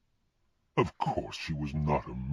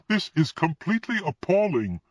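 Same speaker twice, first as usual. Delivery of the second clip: much higher, louder outraged outburst; appalled cry; 13 semitones higher, 4 dB louder than usual. Acted. Woman hears Death offer his office, crying out appalled. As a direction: recoil in shock and cry out. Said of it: "she cried".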